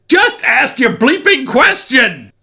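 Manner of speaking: high, loud, slow fed-up yell; exasperated shout. Acted. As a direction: snap at the pest, drawn out. Exasperated outburst at pest.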